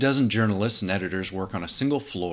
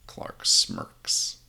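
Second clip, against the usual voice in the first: ordinary talk, nothing marked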